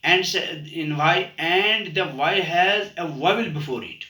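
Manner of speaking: slow, deliberate repeat of the rule. Shown as a demonstration. Teacher repeating the rule for the class, slow and deliberate. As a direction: spell the rule out again slowly. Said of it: "repeat करता हूँ मैं".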